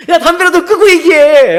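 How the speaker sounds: high, loud acted outburst; exasperated mock scolding. Preacher scolds friend confessing while smoking, exasperated yell.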